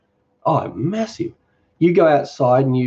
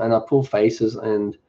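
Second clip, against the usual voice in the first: ordinary talk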